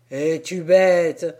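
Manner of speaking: slow, loud scoff; mock your mate. Second sailor scolding his mate, loud scoff.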